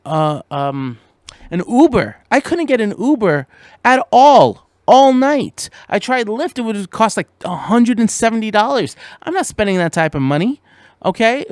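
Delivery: high, loud fed-up rant; stranded driver ranting about no help; exasperated rant. Retold or imitated. Venting about being stranded.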